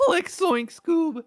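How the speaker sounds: high, loud, slow cartoon yelp; Shaggy. Taking a turn at impressions, Shaggy voice. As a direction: drag out a cracking cartoon cry.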